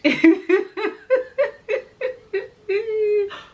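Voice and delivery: long, loud, high laugh; real laugh. Real, not acted. Laughing at her request for English, long high laugh. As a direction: crack up with high, drawn-out laughter.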